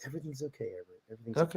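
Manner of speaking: very soft, hushed comforting words; soothing murmur. Acted. Wants to be hugged and told it's fine, soothing murmur. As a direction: murmur it barely audibly, like comfort in a hug.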